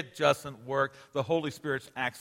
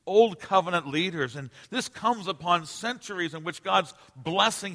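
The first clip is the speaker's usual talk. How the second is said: higher-pitched, projected sermon delivery; impassioned preaching; 6 semitones higher than usual. Real, not acted. Preacher lamenting apostate leaders to his congregation, impassioned preaching. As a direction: preach it high and driving.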